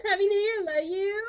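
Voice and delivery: excited greeting. Real, not acted. Greeting a chatter warmly.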